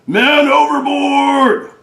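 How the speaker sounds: high, drawn-out shout; sailor's cry. Acted. Sailor shouts to the crew, loud high alarm. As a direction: bellow the alarm, long and high. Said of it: "That sounds like Bucky a little bit".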